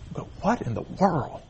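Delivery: quiet astonishment after the wailing; hushed bewilderment. Retold or imitated. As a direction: drop to a hush in disbelief. His stunned reaction to the wailing.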